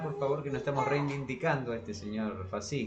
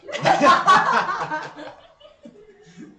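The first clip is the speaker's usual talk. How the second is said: big, much higher, louder burst of laughter; 14 dB louder than usual. Real, not acted. Co-host cracking up at the fascist crush joke. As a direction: howl with laughter.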